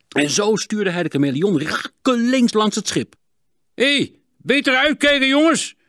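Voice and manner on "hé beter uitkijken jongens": heel hoge, luide uitval; boos roepende schipper. A narrator's reading. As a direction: roep het verwijtend over het water. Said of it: "riep de schipper". (luid schreeuwende schipper)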